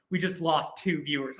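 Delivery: ordinary delivery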